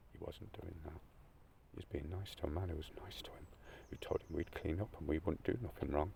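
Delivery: barely holding it together as he defends him; quiet, tearful pleading; tearful, hushed. Real, not acted. Choked up defending the policeman.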